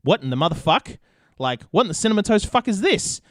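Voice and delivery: rising, high disbelief; stunned disbelief. Retold or imitated. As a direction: react like you can't believe your eyes. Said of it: "And I was like".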